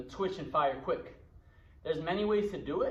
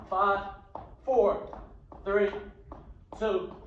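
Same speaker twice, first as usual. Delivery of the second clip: counting down; much slower than usual. Real, not acted. Coach counting down reps.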